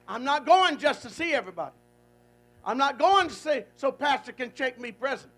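loud, rising preacher's vow; preaching at full force. Acted. Preacher voicing a believer's vow to the congregation, at full force. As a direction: build it up like a Sunday sermon climax.

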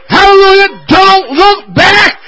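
ordinary talk

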